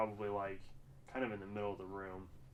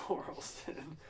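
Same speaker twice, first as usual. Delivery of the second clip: laughing echo; 5 semitones higher, slower than usual. Acted. Echoes co-host's drawn-out place name, laughing.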